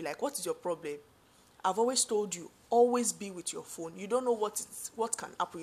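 ordinary talk

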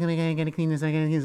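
hammed-up flustered woman; fretting woman. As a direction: imitate her fussing over the mess. Voicing woman fretting over mess.

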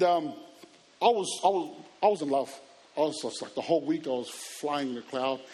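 normal talking voice

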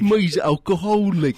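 mock English accent. Cohost joining the bit in a silly English accent. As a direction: join in as a drunk English bloke. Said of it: "It's like".

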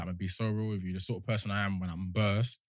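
ordinary delivery

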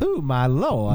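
southern belle drawl; gushing Southern belle; Southern belle accent. Retold or imitated. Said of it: "There it is, yeah".

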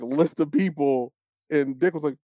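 ordinary talk

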